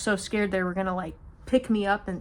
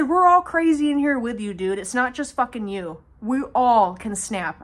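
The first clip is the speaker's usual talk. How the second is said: loud telling-off; 3 semitones higher, 6 dB louder than usual. Retold or imitated. Telling off the man.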